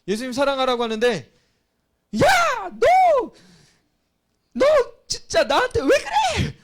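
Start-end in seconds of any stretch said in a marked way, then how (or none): 2.2-6.6 much higher, much louder shouted yell with a laugh; mock angry shout. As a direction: act out yelling at a friend, half laughing. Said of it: "막 소리치고".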